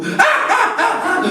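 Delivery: high hooting laugh; mock hooting laughter; mocking boyish laugh. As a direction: hoot with glee like teasing boys. Boys laughing at Krishna.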